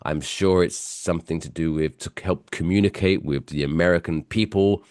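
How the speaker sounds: normal talking voice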